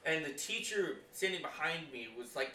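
normal talking voice

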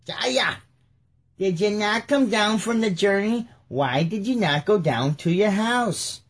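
Jaya voice. Acted. Doing David in the Jaya voice to Uriah. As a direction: pitch it up high and playful. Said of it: "We're just gonna do the Jaya voice".

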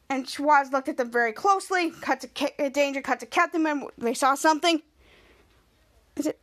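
ordinary talk, nothing marked

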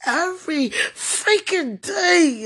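anguished wailing shout; crying out. Real, not acted. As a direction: cry it out in raw grief. Crying outburst over lost years.

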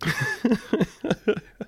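high giggle; helpless giggle at your own silliness; light, high-pitched laughing. Real, not acted. Laughs at own minion impression.

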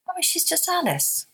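high, lightly voiced small child, innocent; a hint of a five-year-old gently correcting mum; little boy voice. Five-year-old son's words quoted.

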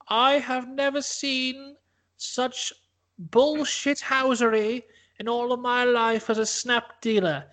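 high, slow formal ruling; pompous judge. Acted. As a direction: announce the verdict grandly. [name] as judge announcing verdict.

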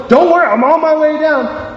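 high, joyful call-out; eager to help, voice leaping up; thrilled, rushing to the rescue; jumping for joy. Acted. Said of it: "jumping for joy".

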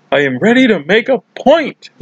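high, swelling proclamation; mock pumped-up declaration. Recapper imitating Michael about to jump, pumped up. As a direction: build up to a triumphant POINT. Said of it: "Michael says".

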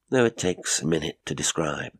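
plain reading voice

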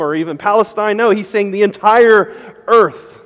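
loud, high, building sermon delivery; full-force preaching. Acted. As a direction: preach it out to the whole room.

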